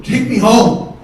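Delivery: defiant yell. Retold or imitated. Brother refusing to leave the car, yelling defiantly. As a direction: fling it back as a mock yell.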